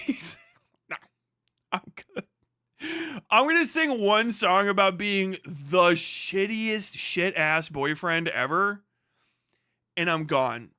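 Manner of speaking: usual speaking voice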